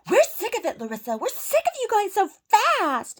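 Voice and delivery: high, loud imitation of a screaming neighbor; shrill-pitched yell imitating an angry woman; mock screaming. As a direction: screech it, mimicking her outrage, stretching the last word. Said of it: "she starts screaming".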